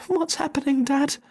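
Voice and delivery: very high frightened little voices; small foxes crying. Acted. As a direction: cry out like scared fox cubs. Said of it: "cried the small foxes".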